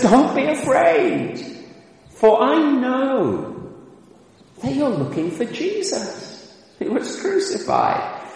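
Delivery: the angel gently reassures the astonished women; kindly angel voice. Retold or imitated. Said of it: "And he speaks kindly".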